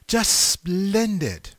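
high, drawn-out mock English accent; attempted English accent. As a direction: stretch it out high in a half-successful English accent. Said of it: "can't say it with that, uh, English accent".

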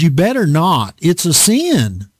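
ordinary talk, nothing marked

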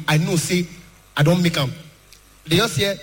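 ordinary talk

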